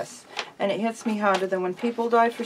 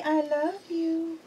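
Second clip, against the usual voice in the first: slow, higher "I love you" with a rising end; slow model phrase; 7 semitones higher, 5 dB quieter, slower than usual. Shown as a demonstration. Owner modelling the words for her bird, slow and high. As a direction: coax it out slowly and softly. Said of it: "Will you tell me".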